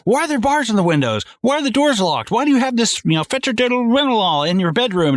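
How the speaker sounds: high, agitated interrogation, acted; mock accusing questions. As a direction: fire off suspicious questions, pitch up high. Acts out paranoid barrage of questions.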